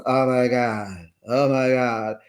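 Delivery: slow, drawn-out stunned repeat; retold shock. Recapping what she kept saying over the ring, in slow imitated shock. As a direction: imitate someone lost for words, slowly. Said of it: "all she kept saying was".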